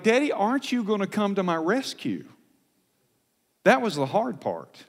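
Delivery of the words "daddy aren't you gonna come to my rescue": high-pitched imagined plea; pleading child. Retold or imitated. Daughter looking to her father for rescue, pleading. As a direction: imitate a child silently begging for rescue. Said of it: "she looked at me like".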